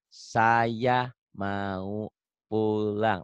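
"Saya mau pulang" stretched out very slowly, each word apart; slow careful reading. Shown as a demonstration. Teacher showing his student how to read slowly, very slow and careful. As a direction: read "Saya mau pulang" syllable by syllable, taking your time. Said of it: "Latihan pelan-pelan".

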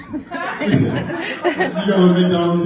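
slow laughing drawl. Retold or imitated. Picturing guys at McDonald's, slow and laughing. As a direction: stretch it out while cracking up. Imagining guys getting free stuff.

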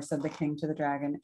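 ordinary delivery

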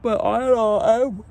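words stretched by a yawn. Real, not acted. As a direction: talk through a yawn. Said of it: "I cannot stop yawning".

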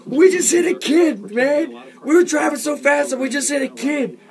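high, loud frantic stoner voice; a high, loud freaked-out stoner; loud stoner panic. Acted. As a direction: panic loudly like a stoned driver. Stoner characters panicking loudly.

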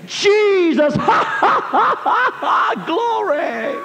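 high, loud laughing cries of Jesus and glory; holy laughter. Retold or imitated. Overcome by the Holy Ghost in the car, laughing shouts. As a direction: burst out laughing in joy. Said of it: "I began to laugh".

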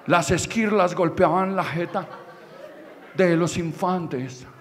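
usual speaking voice

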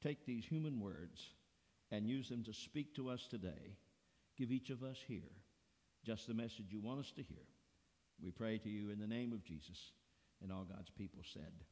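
low, soft closing prayer. Acted. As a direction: pray it gently over the people. Opening prayer.

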